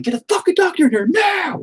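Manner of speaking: high, strained mock yell; frantic angry shout. Father rushing bleeding son into hospital, frantic high shout. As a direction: re-enact a desperate demand at the top of your voice. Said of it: "I was just pissed".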